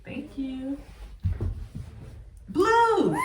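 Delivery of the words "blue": high, loud delighted call; excited shout. Real, not acted. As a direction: call out the color with joy. Announcing the color rolled.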